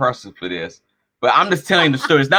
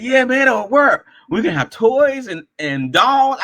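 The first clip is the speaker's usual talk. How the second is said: eager insisting friend; 8 semitones higher, slower than usual. Retold or imitated.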